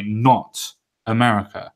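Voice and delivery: usual speaking voice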